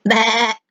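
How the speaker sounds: bleating "baa"; sheep bleat. Shown as a demonstration. Teacher showing what a sheep says, bleating. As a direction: imitate a sheep's drawn-out bleat. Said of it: "A sheep says".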